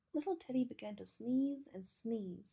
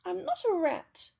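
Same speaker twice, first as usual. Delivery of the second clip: high, loud squeaky retort; offended little bear; 9 semitones higher, 10 dB louder than usual. A narrator's reading. Teddy answering the screaming lady, high indignant growl. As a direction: squeak it back indignantly, much higher. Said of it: "growled Teddy".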